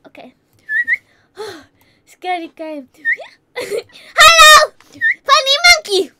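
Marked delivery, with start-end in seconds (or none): 3.6-6.1 high, very loud shout; loud calling out. Real, not acted. Gamer calling out mid-game, loud shout. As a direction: holler it out, high and sudden.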